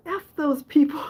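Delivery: half-laugh the defiant line; mom's defiant line retold through a laugh; laughing quote. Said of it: "she said".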